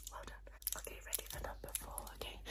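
very soft, quick whisper; encouraging whisper. Pretend. Doctor readying patient for next test, whispered. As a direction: whisper it briskly.

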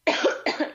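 rough chesty cough from an illness. Real, not acted. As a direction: cough it out, still sick. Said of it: "sorry I'm coughing so much".

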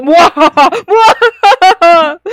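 slow loud evil cackle; mustache twirling villain. Acted. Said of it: "Una voz de fondo".

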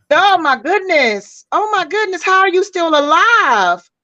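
stunned by a listener's bad marriage; astonished. Real, not acted. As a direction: gasp it out in disbelief. Shocked at listener's story.

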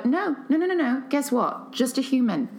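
high, insistent string of denials; acted-out sassy correction; emphatic rebuttal. Retelling her rebuttal to trolls.

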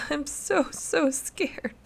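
put-on high, sobbing little girl; sobbing child. A narrator's reading. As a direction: speak through sobs like a terrified child. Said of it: "she said between sobs".

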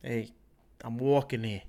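tough New York street guy; New York accent. Pretend. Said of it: "that's a New York accent".

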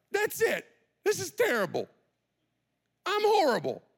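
very high, exasperated outburst; frustrated. Acted. Peter giving up after being rebuked, frustrated. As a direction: blow up at yourself, pitch way up. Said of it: "He's so frustrated".

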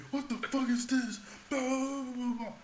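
mock an angry rant trailing into gibberish; imitated yelling. Superior rants in the watch-stander's face, imitated yelling. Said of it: "He just yelling in my face".

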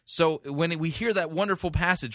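ordinary delivery

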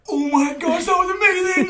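high, loud amazed shout. Retold or imitated. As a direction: shout it like it's the best sound ever. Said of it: "look at each other like".

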